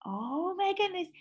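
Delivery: usual speaking voice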